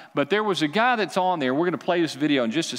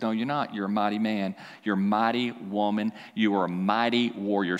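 a hint of God, lower and softer; 6 semitones lower, 3 dB quieter than usual. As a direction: reassure them quietly as God. God reassures the weary listener.

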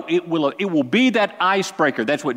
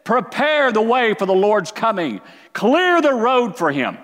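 loud and much higher, slowing on the last words; prophet's shout; 8 semitones higher, 4 dB louder than usual. Acted. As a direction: cry it out across the wilderness. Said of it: "He's shouting this".

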